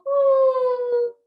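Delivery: high loud hooting woo; owl woo. Shown as a demonstration. Teacher showing kids the owl's high loud woo. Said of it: "look all the way one way and go".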